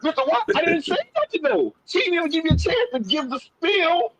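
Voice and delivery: high-pitched, animated disbelief; real excitement, voice lifted high; amazed excitement. Real, not acted. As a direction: exclaim it high, thrilled and incredulous. Teller amazed at banker's offer.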